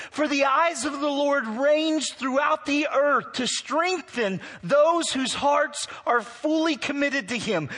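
earnest scripture rebuke; scripture reading. Acted. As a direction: read the prophet's words out to the church. Reads prophet's rebuke.

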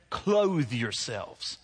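very slow, drawn-out Clothe yourselves; slow emphasis. Real, not acted. Preacher repeating the metaphor to the congregation, very slow. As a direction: stretch each word of Clothe yourselves. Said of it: "that's a powerful metaphor".

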